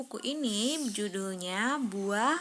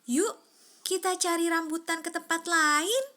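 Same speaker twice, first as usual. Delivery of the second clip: inviting call; 6 semitones higher, 5 dB louder than usual. A narrator's reading. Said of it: "Yaki mengajak teman-temannya".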